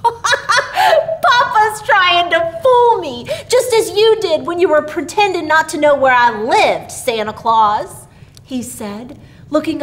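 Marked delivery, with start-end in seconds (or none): 0.0-8.1 high, loud, laughing through the words; little boy giggling at papa's joke; laughing child. A narrator's reading. Billy laughs to Santa about papa's joke. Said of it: "roared with laughter".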